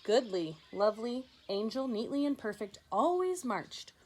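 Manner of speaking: ordinary delivery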